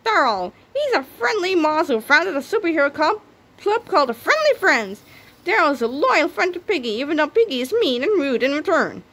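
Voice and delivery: high, bright little mouse voice; squeaky mouse voice. A narrator's reading. Narrator introducing Daryl in a high mouse voice. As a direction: chirp it like a friendly mouse.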